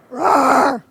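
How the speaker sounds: tiger roar. Shown as a demonstration. Speaker leading the audience in being tigers, playful roar. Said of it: "now we're gonna be tigers".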